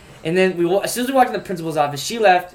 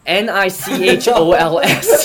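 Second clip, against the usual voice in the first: N I C H O L S, letter by letter, slow, laughing; spelled out slowly; 4 dB louder, slower than usual. Shown as a demonstration. Host spelling out the plaintiff's name, slow and laughing. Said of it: "Should we spell it out for him?".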